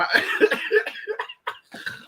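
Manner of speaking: high-pitched cackle of amusement; high laugh. Real, not acted. Cracking up at their own story, high laugh.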